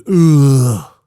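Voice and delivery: loud, drawn-out imitated groan; disgusted grunt. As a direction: groan like a grumpy slob at the TV. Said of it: "it goes".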